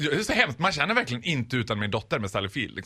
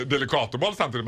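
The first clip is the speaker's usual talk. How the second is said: normal talking voice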